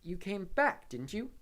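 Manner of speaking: high, loud and pressing; urgent. A narrator's reading. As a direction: push him for an answer. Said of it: "said Harry urgently".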